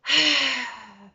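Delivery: big forceful sigh out; loud exhale sigh. Shown as a demonstration. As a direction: blow it all out. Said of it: "sigh it out".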